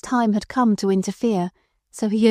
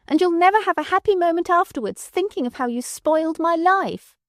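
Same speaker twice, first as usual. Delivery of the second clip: furious; 9 semitones higher than usual. A narrator's reading. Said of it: "went on more wildly than before".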